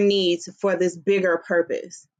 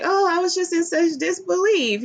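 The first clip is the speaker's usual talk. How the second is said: higher, hammed-up shock in the father's voice; mock disbelief; 10 semitones higher than usual. Daughter mocking her father's fake disbelief, hammed-up. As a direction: play the father pretending to be stunned. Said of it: "he's switching tactics".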